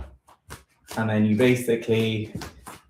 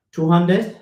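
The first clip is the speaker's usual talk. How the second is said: two-handed? rising sharply high and a bit louder, doubtful; questioning two-handed; 7 semitones higher, 4 dB louder than usual. Real, not acted. Unsure of the exercise name, asking herself out loud.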